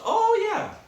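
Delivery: high, loud whoop of confirmation; emphatic shout. Real, not acted. Storyteller confirming his dislocated shoulder, emphatic high shout. Answers own question with a shout.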